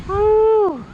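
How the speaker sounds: high woo, dropping at the end; whoop, still thrilled. Real, not acted. Thrill after bungee jump.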